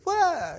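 sudden high loud mock shriek; startled yelp. Girl scared by a slammed locker, a sudden high loud yelp. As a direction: imitate the girl's frightened little scream. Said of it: "you know, like that".

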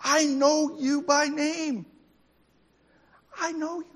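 high, slow, tender voice of God; God to each listener, high, slow and tender; God speaking tenderly. Acted. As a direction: say it slowly and lovingly, like a father. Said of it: "He looks at us and he goes".

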